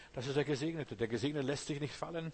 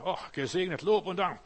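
higher and louder, overdone pious cheer; mock gushing praise; 5 semitones higher, 6 dB louder than usual. Preacher mocking a believer who only knows success, loud mock praise. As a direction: gush it like a smug believer.

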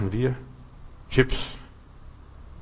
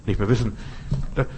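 ordinary talk

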